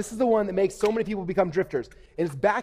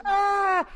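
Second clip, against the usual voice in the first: high-pitched mock scream; drawn-out high "ah", mimicking a panicked scream; imitated scream; 3 dB louder than usual. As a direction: imitate a frightened mom's shriek.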